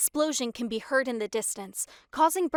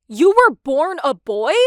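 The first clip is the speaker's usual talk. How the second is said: loud, high astonished question; can't believe what he heard; loud high stunned question; shocked disbelief; 4 semitones higher, 14 dB louder than usual. A narrator's reading.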